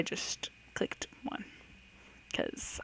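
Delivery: slow, hushed confession whisper. Real, not acted. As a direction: whisper it like a secret. Admits clicking at random.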